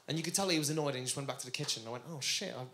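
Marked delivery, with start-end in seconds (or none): 2.1-2.6 low, hushed acted dismay; worried self-questioning. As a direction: quietly wonder what you did wrong. Worried aside after offending the chef.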